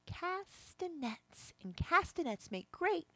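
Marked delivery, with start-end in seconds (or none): 0.1-1.5 drawn out. Shown as a demonstration. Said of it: "These are called".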